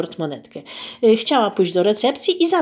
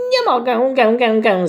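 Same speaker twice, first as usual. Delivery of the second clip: goose honking; 4 semitones higher, 5 dB louder than usual. Acted. Goose Waleria honks her refusal at the narrator. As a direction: honk gę gę gę like a goose, higher, louder. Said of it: "zagęgała gąska".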